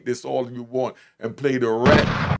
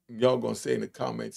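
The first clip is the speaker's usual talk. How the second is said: ordinary delivery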